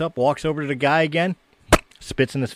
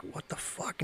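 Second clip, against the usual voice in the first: hushed, whispered disbelief; surprised; 20 dB quieter, slower than usual. Acted. Stunned guy spat on by a leprechaun, surprised whisper. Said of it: "the guy's surprised".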